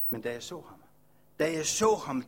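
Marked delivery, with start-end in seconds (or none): 0.1-0.8 softer, hushed first take of the phrase with a falling "så"; hushed awe. Acted. Leper recalling first seeing Jesus, hushed awe. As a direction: breathe it out in quiet wonder.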